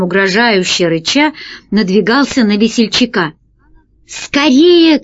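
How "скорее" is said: high urgent shout; rat shouting. A narrator's reading. Rat shouting to his partner, high urgent shout. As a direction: yell it out in a hurry. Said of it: "кричал крыс".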